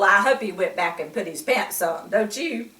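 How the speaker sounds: ordinary delivery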